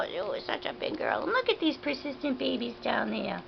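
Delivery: ordinary delivery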